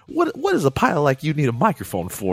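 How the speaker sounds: high, loud curious street voice; mock guard voice. Speaker imitates screener asking pilot about mic, high loud. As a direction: ham up the guard's nosy question. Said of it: "He's like".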